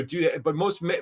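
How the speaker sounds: ordinary talk, nothing marked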